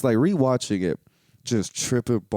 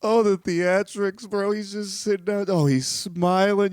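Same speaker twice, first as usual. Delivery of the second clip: mock awe; 10 semitones higher than usual. Mocking Morpheus's drama.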